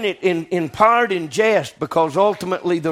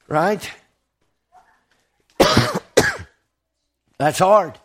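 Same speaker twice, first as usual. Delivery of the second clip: usual speaking voice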